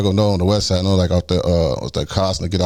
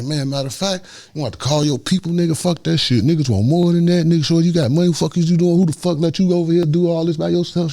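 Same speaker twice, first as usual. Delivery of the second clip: higher, insistent pleading; retold pleading; 8 semitones higher than usual. Passenger bargaining with his abductors, insistent retold pleading. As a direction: argue for a way out, re-enacted. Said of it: "like, begging and pleading".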